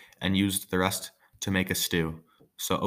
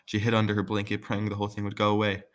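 usual speaking voice

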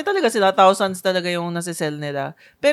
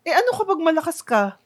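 higher, incredulous question; mock disbelief, pitched up high; imitating Tony's surprise; 9 semitones higher, 4 dB louder than usual. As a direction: play the host caught off guard. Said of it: "nagulat si Tony".

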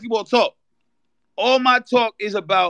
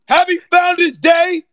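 louder festive shout; 9 semitones higher, 5 dB louder than usual. Real, not acted. Fraternity shout-out.

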